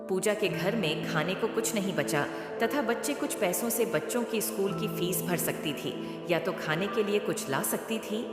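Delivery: ordinary delivery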